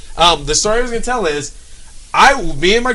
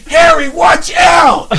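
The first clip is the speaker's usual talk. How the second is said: much higher, loud slow warning shout; mock shout; 11 semitones higher, 7 dB louder, much slower than usual. Moviegoer shouting a warning at the screen. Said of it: "So if you say".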